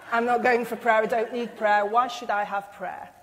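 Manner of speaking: loud, indignant mock refusal; stubborn refusal. Preacher mimics a churchgoer refusing prayer, loud and indignant. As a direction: bristle and refuse, loudly.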